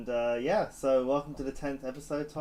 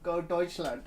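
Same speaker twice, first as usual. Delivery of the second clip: mock German cheer; 5 semitones higher than usual. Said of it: "you would have been like".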